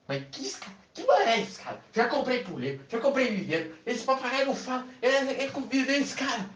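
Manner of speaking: high exasperated protest. Acted. Woman protests to the seller about the ladder. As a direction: protest the new demand, fed up.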